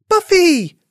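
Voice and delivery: very high, loud call; concerned cry. A narrator's reading. Friend calling to the crying bunny, alarmed. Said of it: "cried a voice".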